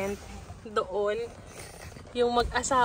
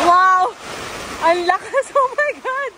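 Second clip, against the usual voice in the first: loud, high gasp of amazement; real startled outburst; amazed; 6 semitones higher, 5 dB louder, slower than usual. Real, not acted. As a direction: exclaim at the force of the wind, breaking into a laugh. Reacting to a violent gust.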